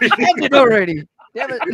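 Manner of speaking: playfully fed-up outburst; mock exasperation. Mock exasperation at overlong track.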